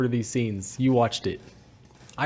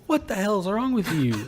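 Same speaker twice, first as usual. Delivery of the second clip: higher, drawn-out exasperated telling-off; mock scolding; 7 semitones higher, slower than usual. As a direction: scold like an exasperated partner, half in jest. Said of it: "Kay just comes out and starts scolding".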